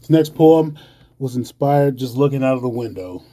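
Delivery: usual speaking voice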